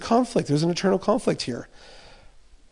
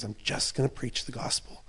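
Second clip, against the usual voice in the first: softer whisper of the same vow; whispered resolve; 6 semitones lower, 11 dB quieter than usual. Acted. Preacher voicing Paul's inner vow, a soft whisper. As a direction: breathe it as a private promise. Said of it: "And Paul's like".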